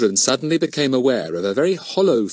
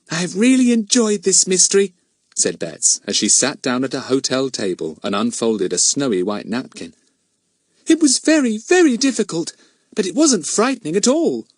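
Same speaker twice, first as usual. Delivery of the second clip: put-on much higher, bright young girl's voice; happy little girl; 7 semitones higher than usual. A narrator's reading. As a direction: chatter cheerfully like a child. Girl chats at lunch table.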